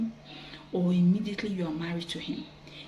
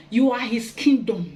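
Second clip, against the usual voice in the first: ordinary talk, nothing marked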